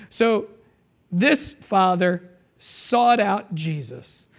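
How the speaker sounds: ordinary talk, nothing marked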